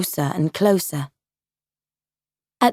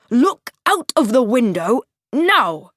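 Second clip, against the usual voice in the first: stern father; 4 semitones higher, 3 dB louder than usual. Acted. Father orders scared son.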